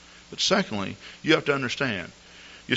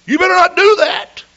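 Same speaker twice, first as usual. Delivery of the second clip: much higher and louder, a hammed-up scold; mock shouted warning; 14 dB louder than usual. Caller mocking what the pastor should have said, hammed-up yell. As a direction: throw the warning back as an overblown yell.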